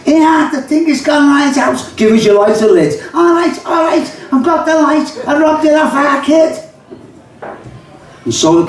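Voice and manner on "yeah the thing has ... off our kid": put-on much higher kid voices; squeaky young Liverpool lads; young Scousers. Lads asking for a lighter, much higher. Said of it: "This is called the kidder conversation".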